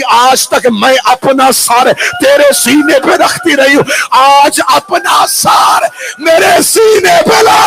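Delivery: slow, high wailing cry; crying plea. Acted. Daughter wailing over her slain father. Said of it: "रो के कहती".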